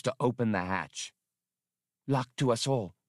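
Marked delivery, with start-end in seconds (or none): none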